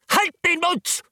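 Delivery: high, sharp rebuke; angry. A narrator's reading. Poole snaps at the wailing maid, high and angry. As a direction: cut the girl off with frayed nerves. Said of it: "in einem wütenden Ton".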